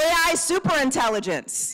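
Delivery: high call to the crowd; rally the room with a prompt. Shown as a demonstration. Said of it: "calling prompts to the crowd".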